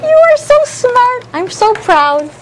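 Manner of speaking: put-on thrilled teacher praising child; high excited praise. Shown as a demonstration. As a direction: squeal the praise. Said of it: "praising the class".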